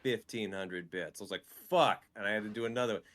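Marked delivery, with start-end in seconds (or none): 1.7-2.0 higher, louder exasperated swear; exasperated curse. Retold or imitated. Streamer told to do another set, exasperated. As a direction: curse harder at yet another demand. Same reaction when asked for another.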